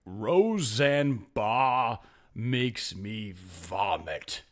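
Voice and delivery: super deep, drawn-out priest voice; Father Donnelly. Acted. Priest opening his sermon to the congregation, deep, intense voice. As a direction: intone it very low and very slowly, intensely. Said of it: "I'm gonna do his voice. It was super deep".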